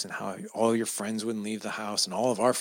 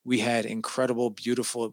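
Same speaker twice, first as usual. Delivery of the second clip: ordinary talk, nothing marked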